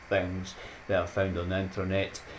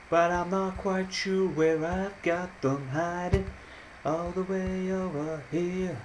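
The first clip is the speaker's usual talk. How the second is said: much higher, slow sing-song search; playful high voice; 11 semitones higher, slower than usual. Pretend. Hunting for hidden gifs, silly high and slow.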